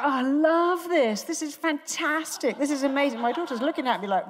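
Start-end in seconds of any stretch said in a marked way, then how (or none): 0.0-3.2 high, hammed-up enthusiasm; shouting out delight. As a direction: shout it as if thrilled, stretching the key words. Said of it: "so I started shouting out".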